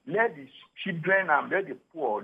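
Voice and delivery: ordinary talk, nothing marked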